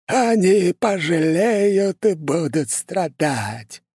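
put-on high, slow, creepy old sorcerer; eerie voice. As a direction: drag each word out like a ghoulish old man. Sinister old curse-maker voiced.

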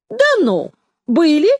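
high-pitched, merry disbelief; cheerful amazement. A narrator's reading. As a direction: jump high in gleeful surprise. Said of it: "весело изумился Ромка".

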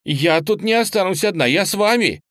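high, loud, urgent plea; alarmed protest. A narrator's reading. Girl refusing to stay locked in alone, alarmed protest.

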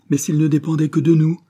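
loud, high-pitched exclamation; crying out. A narrator's reading. Priest to a pleading mother, crying out. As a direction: exclaim in urgent protest. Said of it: "s'écria l'abbé".

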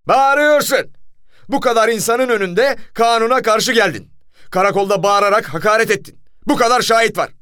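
high, loud furious shouting; commissioner's bellowed accusations; thunderous accusation. A narrator's reading. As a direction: shout the charges at him. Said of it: "Komiserin gök gürültüsü gibi sesiyle".

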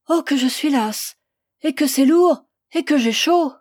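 high, loud tired lament; weary moaning complaint. A narrator's reading. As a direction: complain of the load and heat, moaning. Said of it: "gémit la passante d'un ton navré".

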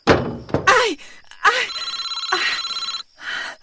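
sharp, high frightened yelps; startled high cries. Acted. Woman startled awake by a late call, yelping in fright. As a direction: jump at the sudden ring.

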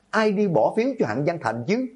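high-pitched angry accusation; angry shout. A narrator's reading. Furious student accusing the girl of the vote, angry shout. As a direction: throw the question at them, voice climbing high. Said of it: "Dương Vũ giận dữ hét lên".

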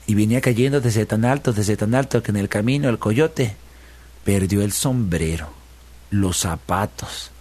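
ordinary delivery, nothing marked

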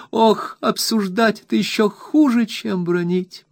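very high despairing wail, slowing down; very high despairing wife's outburst. A narrator's reading. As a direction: wail it up high as if all is lost. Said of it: "в отчаянии воскликнула Дора".

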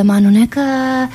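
ordinary talk, nothing marked

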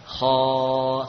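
"Kho" held long on a level note, heavy. Shown as a demonstration. Teacher demonstrating isti'la for students to copy, "Kho" long and heavy. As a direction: stretch a full, back-of-tongue "Kho". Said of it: "terangkatnya suara ke atas".

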